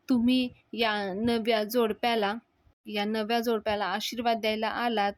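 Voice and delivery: usual speaking voice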